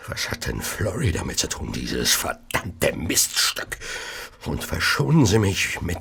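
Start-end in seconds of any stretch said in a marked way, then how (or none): 1.7-3.8 much lower and slow, spat with disgust; contemptuous sneer. Acted. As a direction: drag out the insult with loathing. James curses his wife.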